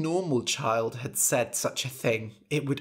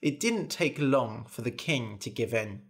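ordinary delivery

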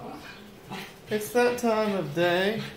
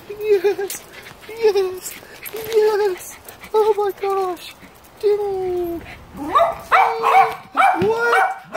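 much higher, louder squealing praise; 14 semitones higher, 4 dB louder, much slower than usual. Real, not acted. Owner squeals with joy as her dog barks, much higher. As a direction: cheer the dog on in a high squeal. Owner cheering dog's barking.

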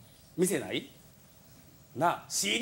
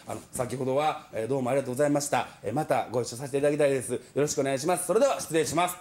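low, rushed formal message; stiff polite voicemail; 8 semitones lower, faster than usual. Retold or imitated. Junior comedian leaving a hurried formal voicemail. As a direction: rattle off a dutiful polite thank-you.

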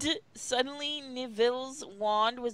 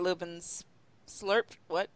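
ordinary talk, nothing marked

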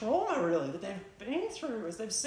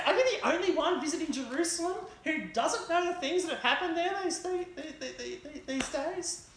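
higher, disbelieving grieving disciple; incredulous disciple; 8 semitones higher than usual. Acted. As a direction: ask the stranger, amazed he hasn't heard. Disciples answer the stranger.

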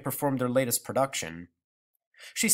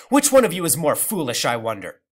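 loud, raised-pitch scorn; scornful cry; 2 semitones higher, 8 dB louder than usual. A narrator's reading. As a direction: challenge them loudly, voice lifted. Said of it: "cried grandmother".

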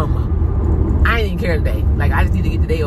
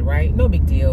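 normal talking voice

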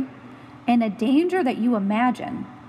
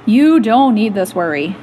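louder, firm statement; a loud, firm answer to the child; emphatic declaration; 3 semitones lower, 9 dB louder than usual. Acted. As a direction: tell them firmly and loudly.

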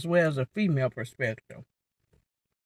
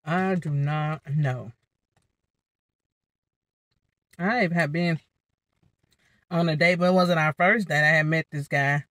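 eating between words; talking while chewing. Real, not acted. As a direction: mouth partly full. Seen 4: Forkfuls taken and lips moving around food between spoken phrases.

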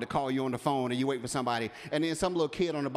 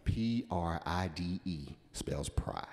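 quiet and slow, letter by letter; slow spelled-out word; 10 semitones lower, 7 dB quieter, much slower than usual. Real, not acted. Preacher spelling pride for the church, slow and quiet. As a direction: spell it out, let each letter land.

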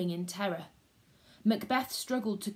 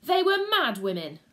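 higher, louder scoffing retort; snorting scorn; 6 semitones higher, 7 dB louder than usual. Acted. Macbeth dismissing the witches to Banquo, scornful snort. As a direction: scoff at the idea. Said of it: "snorted Macbeth".

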